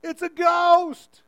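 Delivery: loud, high, slow terrified cry; frightened shout. Retold or imitated. Disciples in the storm boat crying out in fear, loud. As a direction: scream it out like you've seen a ghost. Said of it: "They were scared".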